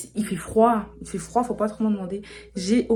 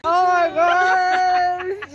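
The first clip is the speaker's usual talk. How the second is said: excited shriek; 10 semitones higher, 9 dB louder, much slower than usual. Real, not acted. Reacts to friend's accent.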